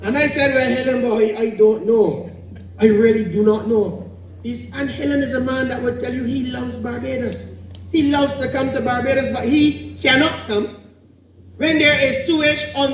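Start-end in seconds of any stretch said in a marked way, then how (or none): none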